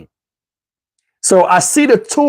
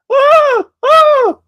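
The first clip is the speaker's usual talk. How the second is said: high-pitched yelping holler; shrill retold scream of pain; howl of pain. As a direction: holler out like you've been stabbed. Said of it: "I'm hollering".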